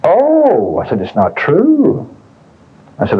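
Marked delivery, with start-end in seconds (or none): 0.0-0.8 high, drawn-out mock oh; retold incredulous reaction; mock surprise. Acted retort to the woman. 1.0-2.1 higher, very slow echoed question; retold incredulous comeback; mock disbelief. Acted retort to the woman.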